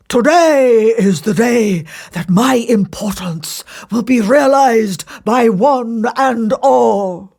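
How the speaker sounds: loud, slow grand boast; boastful. Acted. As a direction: boast as if the world awaits you. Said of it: "he boasted to the Empress".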